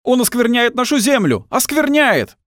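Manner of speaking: очень высокий надрывный крик; обвиняй, крича на пределе высоты; гневный крик толпы. A narrator's reading. Толпа сектантов обвиняет героя. (гневно кричать из толпы)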